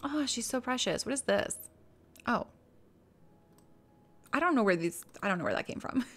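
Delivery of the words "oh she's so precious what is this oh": ordinary talk, nothing marked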